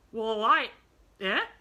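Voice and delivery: loud, startled bluster rising to "eh"; sharp demand. A narrator's reading. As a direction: demand it sharply, cutting himself off. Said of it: "he demanded sharply".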